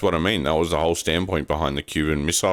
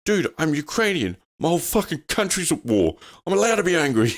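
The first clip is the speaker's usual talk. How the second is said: high, laughing indignant reply; mock indignant guest; 12 semitones higher than usual. Retelling the Ukrainian guest answering the host, high indignant mock. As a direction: play the fed-up guest with a laugh. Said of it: "And he's like".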